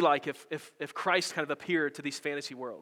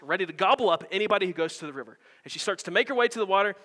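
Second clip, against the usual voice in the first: normal talking voice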